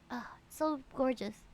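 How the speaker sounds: ordinary talk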